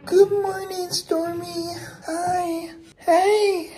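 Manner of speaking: high sing-song good morning; sing-song greeting. Real, not acted. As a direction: greet them sweetly, high. Mother greets sleeping son.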